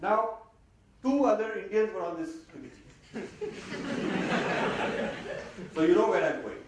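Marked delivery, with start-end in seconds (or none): 3.1-5.7 real laugh. Real, not acted. Laughing at his own story.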